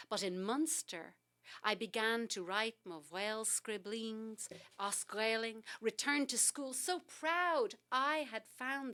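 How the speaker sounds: a lifted, proud recital that ends in a whisper; poem reading. Acted. Poet reads her poem about Irish to the host, earnest recital. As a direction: read it as personal testimony.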